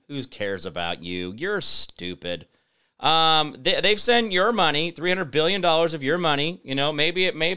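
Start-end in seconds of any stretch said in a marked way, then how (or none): 0.1-2.5 mock dismissive sneer. Mocking the government's indifference.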